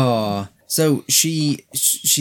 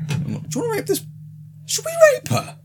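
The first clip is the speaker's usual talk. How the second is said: very high, squeaky voicing of strangers; high squeaky mock voice; faster than usual. Host mocks the three strange men, very high squeaky voice. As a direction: squeak it out like a cartoon creep.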